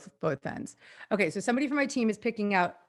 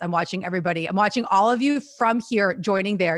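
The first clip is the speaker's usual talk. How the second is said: ordinary talk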